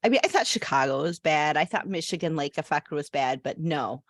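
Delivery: normal talking voice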